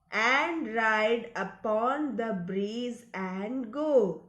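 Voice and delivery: slow, measured model reading; read-along recitation. Shown as a demonstration. Teacher reads the third line for kids following along, slow read-along recitation. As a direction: recite it slowly, each word distinct. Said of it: "you have to read along with me".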